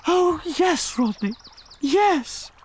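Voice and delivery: high, hammed-up swooning lady; melodramatic heroine. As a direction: overact the heroine. Leading lady accepts.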